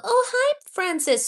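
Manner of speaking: high, bright burst of delight on hi; light up at a familiar name; excited greeting. Real, not acted. Streamer delighted to see her moderator in chat, squealing hello.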